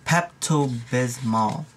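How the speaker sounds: slow, careful Pepto Bismol; really slow. Shown as a demonstration. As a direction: sound out each syllable deliberately. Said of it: "have to say it really slowly".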